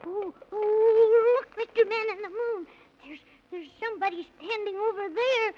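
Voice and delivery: put-on boy alarmed at strangers. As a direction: point it out in a startled rush.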